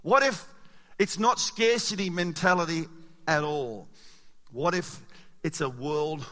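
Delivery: usual speaking voice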